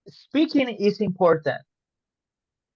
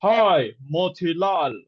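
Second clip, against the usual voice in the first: wrong little-girl impression. Shown as a demonstration. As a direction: badly fake a child with a big grown-up voice. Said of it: "it speaks like this".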